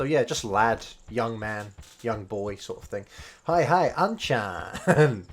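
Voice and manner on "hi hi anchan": slow, higher, louder chirpy greeting; hammed-up slow shopkeeper hello; mock shopkeeper greeting. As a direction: drag out the hi, teasing the shopkeeper. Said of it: "Don't say this".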